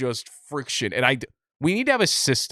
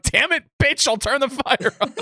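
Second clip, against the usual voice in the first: high, loud hammed-up exasperated guy; mock macho reply; 12 semitones higher, 3 dB louder than usual. Friend mocking the man giving in to a cold woman, loud hammed-up. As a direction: bark it like a fed-up boyfriend, mock. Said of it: "you're like".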